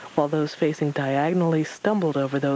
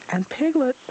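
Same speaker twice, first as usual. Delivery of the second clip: concerned; 10 semitones higher, 3 dB louder than usual. A narrator's reading. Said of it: "Pooh put in with some concern".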